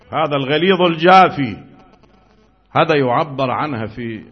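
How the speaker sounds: usual speaking voice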